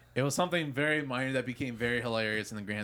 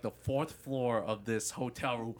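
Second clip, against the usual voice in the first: ordinary delivery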